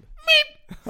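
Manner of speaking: very high, loud imitated squeak; tiny squashed-dog yelp, sharp and sudden. (pipande hundljud, gällt och kort)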